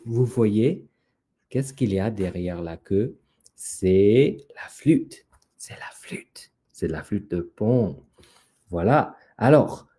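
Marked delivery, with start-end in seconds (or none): 3.5-5.2 drawn-out, voiced announcement; slow reveal. Storyteller shows what is behind the tail, slow reveal. Reveals the hidden flute, first take. 5.6-6.5 very soft whisper; whispered reveal. Acted. Storyteller repeats to the children what was hidden, whispered reveal.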